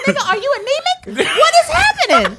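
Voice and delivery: high incredulous questions. Retold or imitated. Friend imitating the girl's stunned reply, high and incredulous. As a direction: ask it in stunned disbelief.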